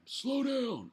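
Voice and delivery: high, drawn-out yell; old guy yelling at a speeder. Retold or imitated. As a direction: holler it at a passing speeder. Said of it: "I yelled at him".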